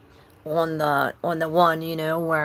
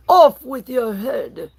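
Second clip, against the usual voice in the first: loud, slow screaming queen; hammed-up royal yell; mock queen's scream; 8 semitones higher, 8 dB louder, slower than usual. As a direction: bellow it like the Queen of Hearts. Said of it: "he's screaming".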